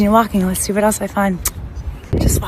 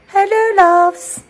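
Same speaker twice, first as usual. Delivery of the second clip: sing-song greeting; 13 semitones higher, much slower than usual. Acted. Vlogger greeting her viewers in a drawn-out sing-song. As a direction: stretch it out like a cheery hello.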